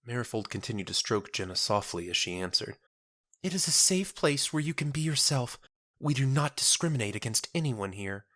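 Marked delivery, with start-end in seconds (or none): 3.4-8.3 put-on a kindly young church sister soothing a stranger; gentle churchwoman. Marifold consoling Jenna in a high gentle voice.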